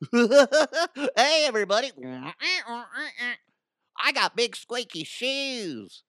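high, goofy clown voice, drawn out slow. Pretend. Podcaster mocking a job ad clown, high goofy clown voice. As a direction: ham up a squeaky-shoed clown.

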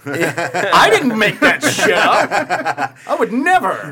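loud, high, drawn-out protest through laughs; laughing protest. Real, not acted. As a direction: protest while cracking up. Mock protest after joke about gorship.

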